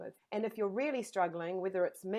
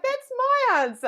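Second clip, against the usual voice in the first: excited realization; 11 dB louder than usual. Retold or imitated. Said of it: "I was like".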